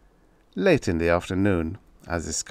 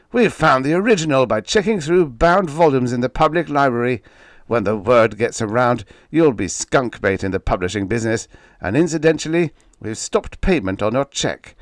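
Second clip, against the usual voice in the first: harsh editor; 5 semitones higher, 6 dB louder than usual. Acted. Angry editor's threat.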